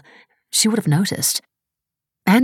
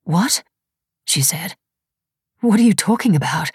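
rising, baffled questions; puzzled disbelief, higher; bewildered; 4 semitones higher than usual. A narrator's reading. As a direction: lift the pitch in confusion. Mira bewildered by Tony.

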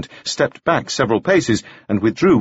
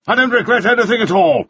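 cried out; 11 semitones higher than usual. Acted. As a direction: fling it out defiantly. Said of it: "he cried".

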